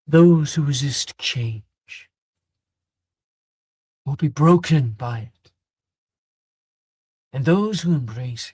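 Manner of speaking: a headmaster preaching change to students; stern headmaster address. Acted. GM voicing headmaster speech.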